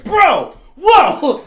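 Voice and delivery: high, loud startled yelp; sudden panicked squeal. Retold or imitated. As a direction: shriek it like the tape just spooked you. Startled by girl's voice on tape.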